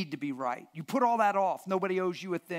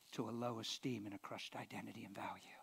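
whisper; 7 semitones lower, 15 dB quieter than usual. Real, not acted. Sermon point dropped to whisper.

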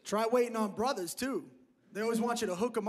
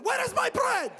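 put-on very high, accented shout; yelling Persian customer; 13 semitones higher, 4 dB louder than usual. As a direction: yell at the waiter in a mock Persian voice. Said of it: "They just yell at you".

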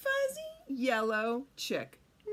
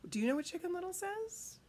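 ordinary delivery

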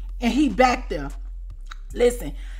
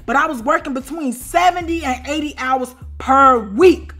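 usual speaking voice

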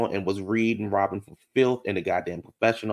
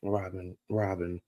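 speechless; 2 semitones lower than usual. Real, not acted. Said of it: "speechless exasperation".

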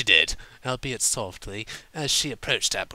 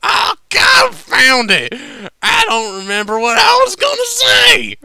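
much higher, very loud frustrated yell; drunken shout; 17 dB louder than usual. Acted. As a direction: bellow it out like a flustered drunk. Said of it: "and shouted".

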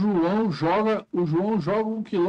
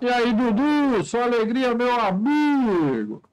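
hammed-up host welcome; 4 semitones higher, 3 dB louder, slower than usual. Greets a viewer like a host.

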